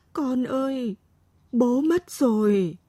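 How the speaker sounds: high, slow, weakened lament; hoarse grieving voice. A narrator's reading. Mother tells daughter her father is gone, hoarse grief. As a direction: break the news in drawn-out grief. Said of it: "Giọng Mế khản đặc".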